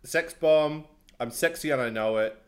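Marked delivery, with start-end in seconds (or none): none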